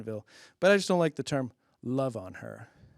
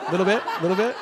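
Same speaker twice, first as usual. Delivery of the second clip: coaxing; 5 semitones higher, 5 dB louder, much faster than usual. Real, not acted. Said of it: "coaxing a laugh".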